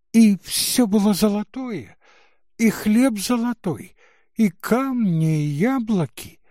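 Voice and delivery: высокий восторженный детский голос; с детским восторгом, звонко; голос девочки. Acted. Девочка переспрашивает бабушку. (восхищённая девочка)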